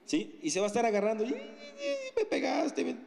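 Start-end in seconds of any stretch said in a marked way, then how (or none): 1.2-3.0 high wailing child's voice with sobs, hammed-up; mock crying child. Instructor playing the bleeding child, high mock sobbing. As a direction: sob it out like a hurt kid whining.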